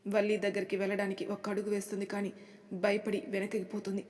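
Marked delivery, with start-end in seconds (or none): none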